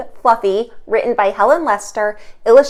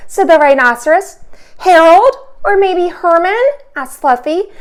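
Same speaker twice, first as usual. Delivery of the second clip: lively, expressive storybook reading; animated read-aloud for kids; reading to children; 4 semitones higher, 4 dB louder than usual. A narrator's reading. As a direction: read it aloud for little listeners. Teacher reads picture book aloud.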